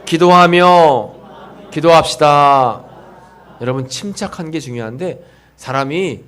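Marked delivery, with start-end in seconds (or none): 0.0-2.8 slow, louder recitation; congregation call. Shown as a demonstration. Pastor leads congregation in repeating the point, loud and slow. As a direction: lead them to repeat each word slowly. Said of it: "한번 따라해 보게".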